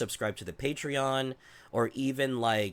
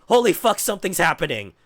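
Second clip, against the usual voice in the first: loud, much higher mock panic; hammed-up in-flight terror, stumbling over words; retold panic on a plane; 5 semitones higher, 6 dB louder, faster than usual. Nervous flyer reenacting plane dip, in loud mock panic. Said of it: "then think".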